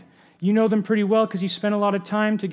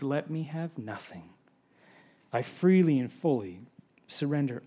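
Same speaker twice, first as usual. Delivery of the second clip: reverent prayer reading. Acted. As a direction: read it as a solemn vow, hushing toward the end. Reads a covenant prayer aloud.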